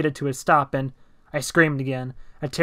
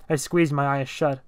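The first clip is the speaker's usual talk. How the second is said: plain reading voice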